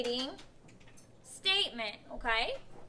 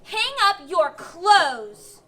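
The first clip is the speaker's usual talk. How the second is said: loud, slow, emphatic order; forceful, drawn-out loud command; excited command; 9 dB louder, much slower than usual. Shown as a demonstration. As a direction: bark it out like you mean it now. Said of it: "Then what would I use? Exclamation".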